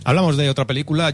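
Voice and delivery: ordinary delivery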